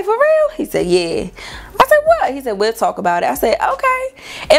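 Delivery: high squeaky surprised replies; high startled replies to boyfriend's "bone to pick"; mock surprised.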